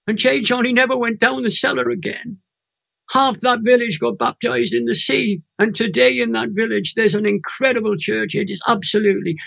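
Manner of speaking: fighting back tears as the story lands; choked up, real emotion; near tears. Real, not acted. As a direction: voice lifting and thinning, close to tears. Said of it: "I'm gonna cry now".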